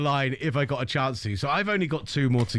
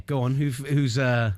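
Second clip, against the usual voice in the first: usual speaking voice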